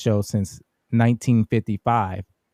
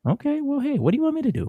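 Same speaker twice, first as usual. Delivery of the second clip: much higher, squeaky-high protest; high-pitched protest; much faster than usual. Real, not acted. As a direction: protest way up high in your range. Protests at being corrected on name.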